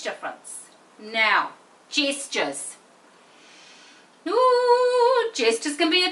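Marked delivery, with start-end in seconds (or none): 4.3-5.3 sung ooh. Real, not acted. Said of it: "tricky one".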